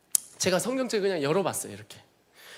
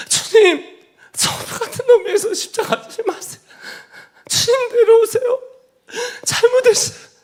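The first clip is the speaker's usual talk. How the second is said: much higher, louder, sobbing begging; crying plea; 7 dB louder than usual. Retold or imitated. Begging Jesus on the cross to come down, crying loudly. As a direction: wail it out, pleading for him to come down. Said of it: "예수님의 손을 들고 그랬어요".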